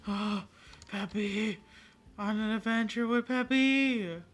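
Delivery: high, loud, slowed delight; excited. Real, not acted. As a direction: squeal it with pure joy. Thrilled to join Peppy.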